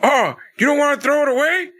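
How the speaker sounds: high, bitter mock Arn voice. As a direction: throw the question back at Cody like a jab. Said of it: "he's like".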